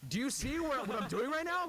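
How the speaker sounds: high-pitched mock exasperation; exasperated protest. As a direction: protest as if just woken, pitch shooting way up. Acts out protest to chatty mother.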